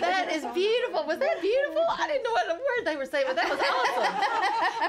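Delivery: high, laughing gush of delight; excited laughing. Real, not acted. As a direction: gush with giggly amazement. Host gushing, laughing after Spanish.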